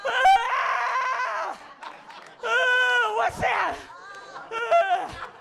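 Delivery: high, loud amazed shrieks; high startled cries at imaginary things; tripping baby. Acted. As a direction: shriek in wonder like a baby on acid. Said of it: "they look like they're mid-peak".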